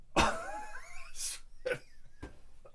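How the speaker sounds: high, breathy mock retch; imitated dog retching. Telling how the dog threw up, mock retching squeal. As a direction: voice the dog's heave as a strange squeal.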